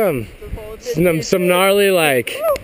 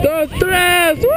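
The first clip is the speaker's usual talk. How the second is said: high, bright countdown cheer; excited cheer; 8 semitones higher than usual. Real, not acted. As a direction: cheer her off the top.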